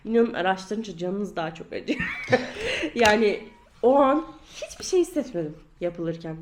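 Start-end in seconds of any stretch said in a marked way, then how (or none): 2.0-2.9 short high-pitched laugh. Real, not acted. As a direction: burst into a high giggle. Laugh after joke.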